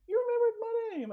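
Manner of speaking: high, bright surprised exclamation; a teacher stunned that a former student remembers her; surprised delight. Retold or imitated. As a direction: gasp it out in happy surprise. Retelling surprised reunion with student.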